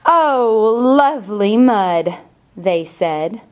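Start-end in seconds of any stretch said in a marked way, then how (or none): 0.1-2.2 cow voice. Acted. Reads picture book aloud.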